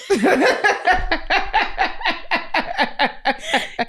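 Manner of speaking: long high helpless laugh. Real, not acted. Big laugh.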